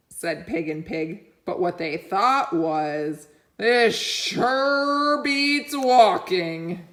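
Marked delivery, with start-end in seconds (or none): 3.6-6.9 very slow, drawn out and content; lazy pig voice. Acted. The pigs in the back, pleased not to walk. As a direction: savour every word like a lazy pig.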